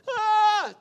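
high, loud mock cry of a hurt little girl; imitating a child's loud frightened wail; imitated child's wail. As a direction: wail like a scared bleeding child. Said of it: "she went from".

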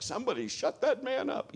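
high, exasperated mock complaint; annoyed passenger. Passengers turning round at the drunk man, high and exasperated. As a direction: sound fed up with the noisy drunk.

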